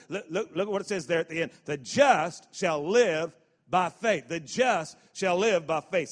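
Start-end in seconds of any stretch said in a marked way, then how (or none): 1.6-4.3 slow, loud and weighty; slow emphatic recital. Shown as a demonstration. Preacher leading the congregation in a slow, loud recital. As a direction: lay each word down slowly. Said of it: "Say that part with me".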